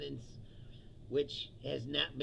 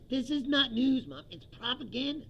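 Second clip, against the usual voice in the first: raised, higher voice insisting hard; retold argument with mom; 8 semitones higher, 6 dB louder than usual. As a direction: tell mom she's wrong, loud and firm. Arguing with mom about Fox.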